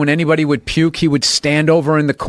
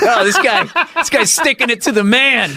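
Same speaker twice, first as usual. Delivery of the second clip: higher, laughing mock voices of jeering onlookers; laughing bystanders; 10 semitones higher than usual. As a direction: imitate muggers laughing at him, high. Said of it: "they were, like, laughing".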